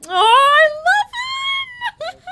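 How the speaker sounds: high, loud delighted cry; excited gush. Real, not acted. Gamer gushes over her Sim husband, high and loud. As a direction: burst out in delight.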